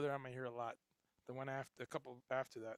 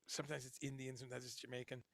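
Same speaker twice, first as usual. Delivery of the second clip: ordinary talk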